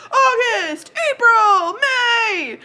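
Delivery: high, loud drawn-out calls; yelling out. Acted. Game master as Hammerling greeting women behind the bar, yelling out. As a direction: hail each friend across a noisy room. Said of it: "Hammerling yells out".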